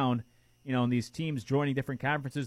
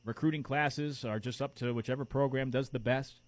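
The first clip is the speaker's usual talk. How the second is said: ordinary delivery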